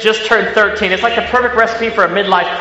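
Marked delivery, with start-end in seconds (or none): none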